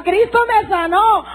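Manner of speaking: high, slow cry of joy; retold cry. Preacher voicing a healed woman's slow, high cry of joy. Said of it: "Otra gritaba".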